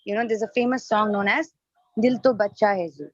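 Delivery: normal talking voice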